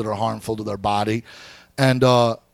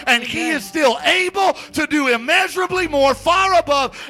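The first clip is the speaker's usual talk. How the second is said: high, driving, fervent preacher voice; impassioned exhortation, pitched high with punched phrases; full-force preaching; 10 semitones higher, 5 dB louder than usual. Real, not acted. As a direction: build it to a ringing pulpit climax.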